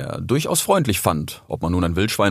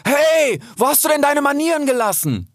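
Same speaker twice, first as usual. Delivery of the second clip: high, indignant shout; bold, ringing rebuke, pitched high; full-throated call. A narrator's reading. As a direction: scold at the top of your lungs. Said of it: "rief aus voller Brust".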